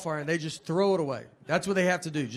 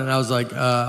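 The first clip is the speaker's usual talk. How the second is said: usual speaking voice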